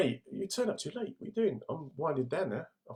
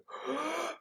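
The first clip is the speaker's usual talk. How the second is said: sharp demo inhale; 5 dB quieter than usual. Shown as a demonstration. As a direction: gasp in a big breath.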